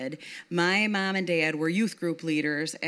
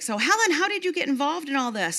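higher, curious friend; Yvonne's voice; 9 semitones higher than usual. Retold or imitated. Yvonne asking Helen over breakfast, lightly voiced higher. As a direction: ask it up high with interest. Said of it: "she's like".

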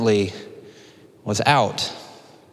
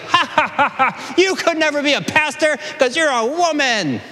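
much higher, mocking fake laugh; mock laughter; 12 semitones higher than usual. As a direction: sneer like smug old men laughing. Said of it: "start laughing like".

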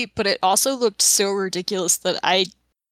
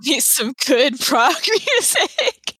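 high, drawn-out laughing delivery; gleeful, pitched up and broken by chuckles; 5 semitones higher than usual. Real, not acted. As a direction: laugh your way slowly through the words. Amused joke about loving prog.

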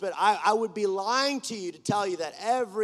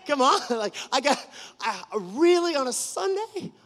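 incredulous protest; 6 semitones higher, 5 dB louder than usual. Retold or imitated. Retold protest at bad timing.

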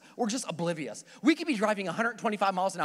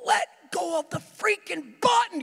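much higher, louder slow yell; inner shout; 10 semitones higher, 4 dB louder, slower than usual. Retold or imitated. Driver silently screaming at the cop holding the breathalyzer. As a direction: yell it in your head. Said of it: "I'm thinking to myself".

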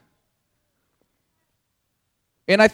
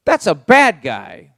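loud protest; 6 dB louder, slower than usual. Acted. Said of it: "And you go".

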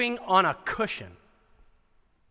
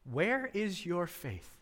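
calm rebuke; 12 dB quieter, much slower than usual. Acted. Jesus calmly rebukes disciples.

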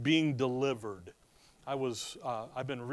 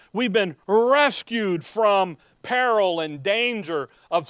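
slower, louder sermon cadence; preaching proclamation; 4 semitones higher, 6 dB louder, slower than usual. Acted. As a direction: drive home rescued with force.

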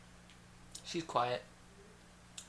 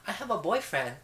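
giddy interview voice; 5 semitones higher than usual. Shown as a demonstration. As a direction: demo of the sing-song boast. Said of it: "talking on the interview like this".

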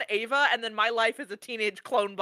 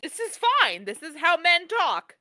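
oblivious; 6 semitones higher, 4 dB louder than usual. Retold or imitated. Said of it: "I was just like".

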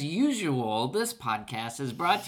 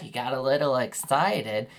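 usual speaking voice

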